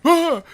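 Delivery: sudden high startled cry; startled yell. Retold or imitated. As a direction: yelp like the enemy surprised you. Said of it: "you're like".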